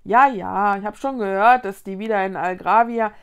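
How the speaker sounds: loud, dismissive know-it-all tone; slightly bored. A narrator's reading. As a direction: brush it off, as old news. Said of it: "Es klang fast ein bisschen gelangweilt". (leicht gelangweilt abwinkend)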